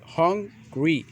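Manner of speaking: "hungry" slowly split as hun... gree; syllable demonstration. Shown as a demonstration. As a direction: stretch "hungry" into two beats, long ee ending. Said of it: "Two syllables ending in".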